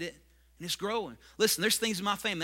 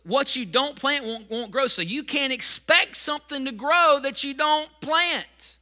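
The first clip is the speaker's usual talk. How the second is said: rising, louder pulpit preaching; preaching fervour; 6 semitones higher, 6 dB louder than usual. Acted. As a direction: drive each point home from the pulpit. Preaching the fourth principle.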